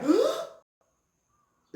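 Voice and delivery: fast, high, startled gasp; shocked gasp. Real, not acted. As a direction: gasp in disbelief. Shocked at a slur.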